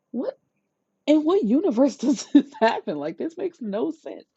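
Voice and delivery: what universe, said laughing and high; incredulous laugh. Real, not acted. Vlogger reacting to the story in amused disbelief.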